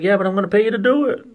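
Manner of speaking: high, loud, quick retort; exasperated customer. Retold or imitated. Customer argues with the mechanic, high exasperated retort.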